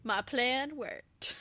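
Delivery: slow, soft, sly villain murmur; scheming whisper. Retold or imitated. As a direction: gloat quietly like a schemer. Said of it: "Kat's ... just like".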